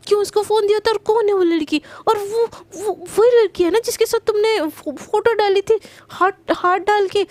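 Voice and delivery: high, loud jealous scolding, fast in places; furious girlfriend hammering out questions; jealous angry threat. Acted. Priyanka scolds Garima in a jealous rage, loud and high.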